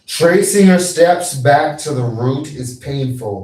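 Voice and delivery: thundering wizard decree; booming wizard. Acted. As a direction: declare it like an ancient sage. Wizard pronouncement.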